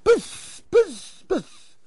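short repeated hisses; air let out. Acted. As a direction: puffs of escaping air, fading. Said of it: "כולם מוציאים אוויר".

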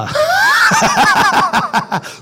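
a high, loud burst of laughter. Real, not acted. Speaker laughing at his own sign joke, loud and high. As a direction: crack up loudly.

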